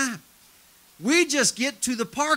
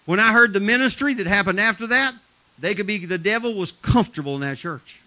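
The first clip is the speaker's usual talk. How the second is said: normal talking voice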